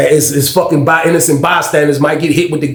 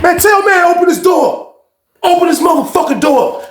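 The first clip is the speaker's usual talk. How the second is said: angry demand; 14 semitones higher than usual. Retold or imitated. Demanding the door open.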